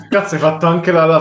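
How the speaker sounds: talking through real laughter; laughing. Real, not acted. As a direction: laugh out the words. Cohost cracks up laughing.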